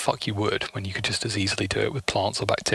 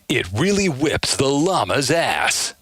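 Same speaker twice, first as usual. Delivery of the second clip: slogan impression; slower than usual. Quotes the Winamp slogan.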